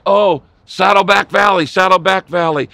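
loud, impassioned call over the land; passionate prayer cry. Retold or imitated. Preacher praying from a hill over his valley, passionate cry. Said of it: "I have prayed that prayer".

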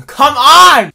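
very loud, high shout; exasperated yell. Acted. Reacting to bad news, exasperated yell. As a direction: burst out at full volume.

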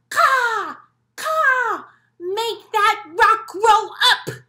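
loud, high, slow crow cawing; grumpy crow voice. Acted. Teacher showing the kids a grumpy crow's loud caw. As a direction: squawk it like a grumpy jealous crow. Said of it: "a grumpy face and a grumpy voice".

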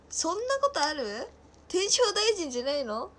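high, loud incredulous questioning. Real, not acted. Streamer doubting a viewer's correction, loudly incredulous. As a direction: protest as if you can't believe it.

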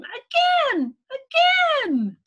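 high, loud acted child's yell; gleeful scream. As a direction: scream it out as an eager little pea. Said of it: "Little P would scream".

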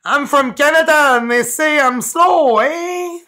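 high, loud mock Canadian accent with eh; Simpsons Canadian kid. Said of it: "from The Simpsons".

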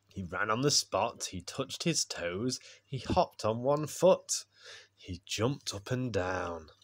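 put-on expressive read-aloud for a child, with pauses; bedtime story narration. A narrator's reading. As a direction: read a picture book at bedtime.

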